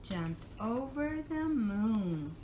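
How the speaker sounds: put-on reading to a toddler.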